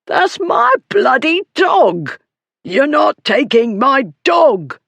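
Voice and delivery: high, drawn-out furious shout; bully howling over his dog; outraged shout. A narrator's reading. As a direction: yell in strained, high-pitched outrage. Bully protests losing his dog.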